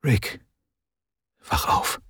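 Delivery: gentle hushed wake-up; soft urging whisper; softly. A narrator's reading. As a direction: coax a sleeping child awake quietly. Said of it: "sagte Dilith leise".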